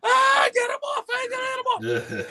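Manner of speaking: very high, shrieking mock panic; imitated panicked screaming. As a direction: scream like a man with a police dog on him. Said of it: "screaming at the cop".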